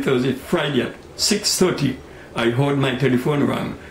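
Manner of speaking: normal talking voice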